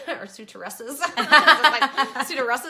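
laughing at the silly word. Real, not acted. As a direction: giggle through the word. Laughing at own coined word.

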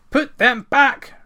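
slow, loud, high furious command; angry shout. A narrator's reading. Ranald orders the brownie, angry shout. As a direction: shout each word, beside yourself. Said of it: "shouted Ranald, almost beside himself with anger".

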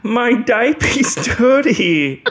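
put-on high, slow, laughing baby whine. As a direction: whine like a giggling toddler. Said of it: "what's all be babies this episode".

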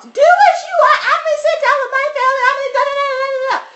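high, loud shrieking rant; hammed-up shrill tantrum; mock screaming customer. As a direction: squeal it out like a furious entitled customer. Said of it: "doing the whole yelling, screaming".

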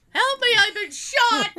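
high, loud hammed-up cry; mock yell for help. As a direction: yell it like you're shot in a store. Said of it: "I should have yelled that while".

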